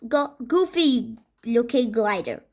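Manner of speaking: plain reading voice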